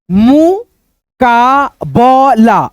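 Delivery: मुकाबला stretched very slowly with a rising glide; slow syllable demo. Shown as a demonstration. Teacher showing students how to read मुकाबला, very slowly. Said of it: "अब यहां पे देखो".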